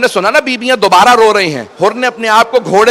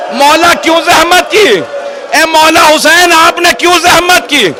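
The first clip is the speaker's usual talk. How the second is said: grieving cry; 6 semitones higher than usual. Acted. Hurr crying out to Imam over his son's body. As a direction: wail it out, building in anguish.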